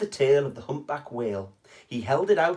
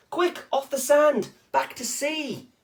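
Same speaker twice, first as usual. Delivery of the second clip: much higher, urgent call; urgent cry; 11 semitones higher than usual. Acted. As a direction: cry out in alarm to save him. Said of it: "cried the snail".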